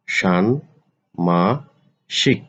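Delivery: ষান্মাসিক stretched slowly as shan-ma-shik; slow model pronunciation. Shown as a demonstration. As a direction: teach ষান্মাসিক slowly, syllable by syllable. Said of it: "আলোচনা করব শব্দের উচ্চারণ". (ষান্মাসিক ধীরে টেনে উচ্চারণ)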